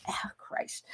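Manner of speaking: breathless whispered exclamation; whispered oath. Real, not acted. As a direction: mutter it under the breath in a whisper. Blurted oath while grabbing tissue.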